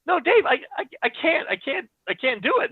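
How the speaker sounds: high, stammering refusal; retold panic. Friend begs Dave not to make him go on, panicking.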